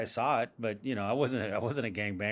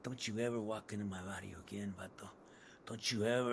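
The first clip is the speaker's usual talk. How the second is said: low, much softer, threatening street voice; menacing gangster; 4 semitones lower, 11 dB quieter than usual. Acted. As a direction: lean in and growl a quiet barrio threat. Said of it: "I'm, like, doing this thing".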